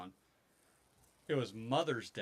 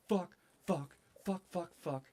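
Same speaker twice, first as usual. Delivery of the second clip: rapid higher panicked cursing; reliving the panic with higher swearing; mock panic; 6 semitones higher than usual. Panic over broken tooth.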